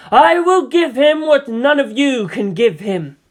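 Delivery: high, loud, slow proclamation; imperious seer at full force; impassioned declaration. Acted. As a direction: build to a ringing vow over them all. Farseer's climax in the quarrel.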